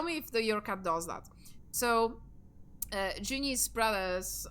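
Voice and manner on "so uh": ordinary delivery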